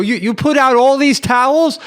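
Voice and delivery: high, loud, disbelieving question; incredulous yell. Retold or imitated. Acted shouting match over towels.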